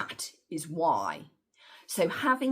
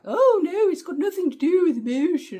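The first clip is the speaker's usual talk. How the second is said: very high, loud mock old-lady voice; mocking grandma; 13 semitones higher, 10 dB louder than usual. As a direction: squeal it out like a huffy grandmother denying everything. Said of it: "now she's like".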